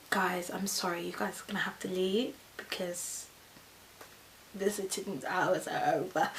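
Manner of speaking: soft, near-whispered apology; hushed, low-key request; apologetic nurse. Retold or imitated. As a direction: gently break it to the visitors. Nurse gently sending friends away.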